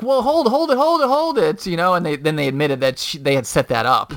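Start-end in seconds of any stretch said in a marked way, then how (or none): 0.0-1.7 high, hurried stop stop; pleading to halt the call; frantic neighbors. Retold or imitated. Neighbors stop the police call, high hurried pleading. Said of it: "they're like".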